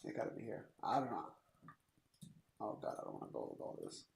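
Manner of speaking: low muttered inner monologue; Hillary Clinton. Retold or imitated. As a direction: mutter it under your breath, nearly whispered. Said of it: "She's like".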